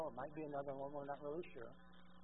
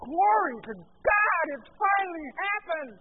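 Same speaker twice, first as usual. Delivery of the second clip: loud, high exultant praise; an angel shouting glory at the end of days; archangel's joyful cry; 9 semitones higher, 12 dB louder than usual. Acted. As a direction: proclaim it in triumph. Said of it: "He's just saying".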